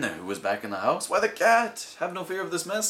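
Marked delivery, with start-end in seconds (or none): 1.1-2.9 cheerful cat. Acted.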